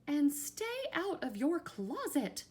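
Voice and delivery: high, loud parting warning; stern mother's order. A narrator's reading. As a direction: call out a last motherly command. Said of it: "she added".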